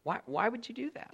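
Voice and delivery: soft plea. Acted. Preacher asking his congregation again, soft pleading. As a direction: drop to a near hush and ask again. Same question repeated softly.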